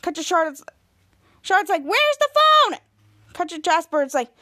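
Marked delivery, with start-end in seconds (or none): 1.8-2.8 high, louder shouted question; acted urgent demand. Charlotte pressing the breathless boy, urgent mock yell.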